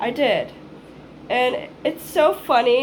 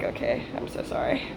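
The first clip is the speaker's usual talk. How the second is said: whispered apology; 10 semitones lower, 8 dB quieter than usual. Retold or imitated. Apologizing to the driver.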